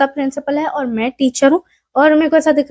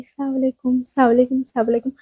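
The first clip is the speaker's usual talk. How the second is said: "अस्सलाम वालेकुम" said much softer, barely out, shy; tiny timid voice; 5 dB quieter, much faster than usual. Shown as a demonstration. Shy vlogger shows how she greets people outside, tiny soft voice. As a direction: greet like a scared introvert who can hardly speak. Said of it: "तो मेरी आवाज इतनी सी रहती है".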